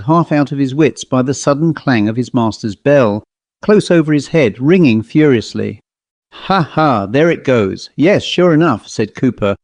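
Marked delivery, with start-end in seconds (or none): none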